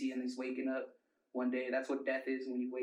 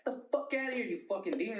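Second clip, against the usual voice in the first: high-pitched panicked outburst, re-enacted; freaked-out yell; 9 semitones higher, faster than usual. Tripping kid orders grandpa out, imitated freaked-out yell. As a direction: shriek it as if banishing a demon, acted. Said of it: "straight up just like that".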